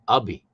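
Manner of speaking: "Ubby" opening on a short "uh" sound; "Ubby" as UH-bee; corrected pronunciation. Shown as a demonstration. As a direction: say "Ubby" with "uh", not "ah". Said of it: "he corrected our pronunciation".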